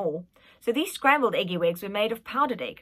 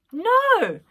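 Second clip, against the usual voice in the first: defiant no; 13 semitones higher, 8 dB louder than usual. Acted. Prince refusing.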